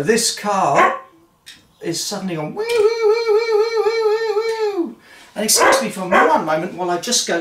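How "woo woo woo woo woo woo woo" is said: very high, loud wailing siren imitation; imitated car alarm.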